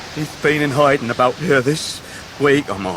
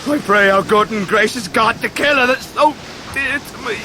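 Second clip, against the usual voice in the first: anguished plea; 8 semitones higher than usual. Acted. Ham's anguished prayer over Emily.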